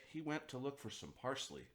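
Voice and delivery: ordinary delivery, nothing marked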